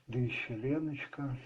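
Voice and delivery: normal talking voice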